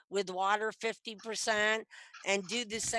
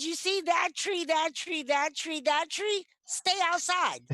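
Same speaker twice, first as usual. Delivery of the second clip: high-pitched, insistent retold warning; comic re-enactment of guarding your patch, voice pitched up; territorial warning; 8 semitones higher than usual. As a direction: mark off each boundary emphatically, then order them out. Retells marking out her digging territory.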